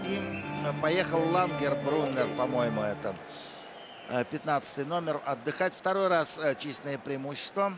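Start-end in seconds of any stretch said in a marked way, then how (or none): none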